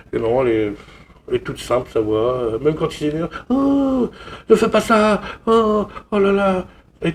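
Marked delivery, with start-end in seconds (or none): 3.5-6.7 mocking the bland French dub voice. Vlogger parodies the French dub of an angry hero, high and limp. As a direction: mimic a limp, toothless French dubbing actor. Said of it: "il parle un peu comme ça".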